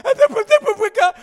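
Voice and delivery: very high, loud, slow, garbled wail; swollen-tongue wail. Retold or imitated. Boy with a swollen tongue running on the steps, wailing garbled words. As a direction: cry it through a swollen tongue. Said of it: "just going".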